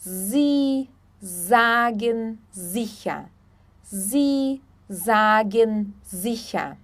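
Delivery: "Sie sagen sicher" word by word, buzzing Z at each start; very slow "Sie sagen sicher" with S voiced as Z. Shown as a demonstration. Teacher showing German S, slow "Sie sagen sicher" with Z. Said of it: "S sempre vai ter som de Z".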